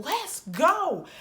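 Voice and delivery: high-pitched urgent call, acted out; retold impatient holler. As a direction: call a dawdling friend from outside the door. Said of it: "out the door saying".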